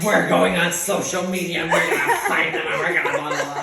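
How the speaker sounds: loud mock battle rant with laughter; hounds of war. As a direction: imitate a riled-up cast vowing revenge. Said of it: "it was literally like that".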